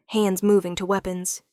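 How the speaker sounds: usual speaking voice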